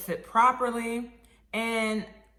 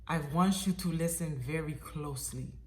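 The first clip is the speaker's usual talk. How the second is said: put-on measured Russian accent, softer and low; 4 semitones lower, 5 dB quieter than usual. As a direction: imitate a grave Russian caller. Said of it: "in my best Russian accent".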